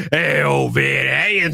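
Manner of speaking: stretched-out, shouted guest introduction; announcer-style name call. Acted.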